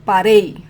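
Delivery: paREI, ending hit loud; emphatic one-word demo, "parei" stressed on rei; stress last syllable. Shown as a demonstration. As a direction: punch the final -rei of "parei". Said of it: "it's the last syllable".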